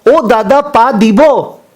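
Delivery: Bengali calling out. Retold or imitated. Bengali passenger asks the Punjabi to move his hand, slow high call. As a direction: drag it out, asking him to move. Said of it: "तो बंगाली वापस से बोला".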